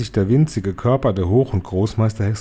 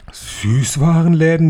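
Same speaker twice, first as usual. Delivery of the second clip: higher, louder, slow gasped Süßwarenläden?; higher, loud, astonished Süßwarenläden?; gasping; 6 semitones higher, 5 dB louder than usual. Acted. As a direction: gasp out Süßwarenläden? in disbelief. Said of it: "japsten sie".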